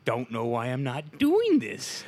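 high, loud incredulous rant; mock exasperated pleading. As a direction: work yourself up into a high-pitched protest. Imagining pitching it to his wife.

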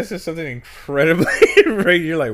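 laughing while talking; loud, high and giggly. Real, not acted. Laughing at racist joke.

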